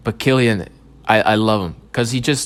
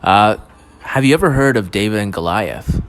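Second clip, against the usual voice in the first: mystery documentary narrator; 2 semitones higher, 4 dB louder than usual. Acted. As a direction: tease the audience like a late-night mystery show host.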